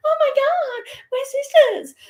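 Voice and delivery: hammed-up, very high squealing teen girl; teenage girls. Mimicking teenage girls finding a bond, mock high squeal. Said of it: "Like teenage girls have just been here".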